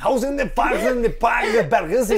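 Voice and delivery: high, hammed-up fake German; mock German. Showing how unsexy German is, high mock German. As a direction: parody German pillow talk with nonsense words. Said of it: "el único país que no sirve es el alemán".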